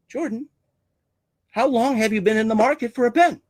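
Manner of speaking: high-pitched, careful question; probing sales question. Acted. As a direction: gently sound out the buyer. Acted sales question to Jordan.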